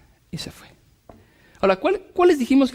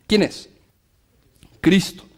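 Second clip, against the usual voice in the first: normal talking voice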